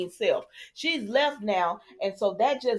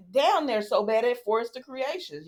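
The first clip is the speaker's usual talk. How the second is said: ordinary talk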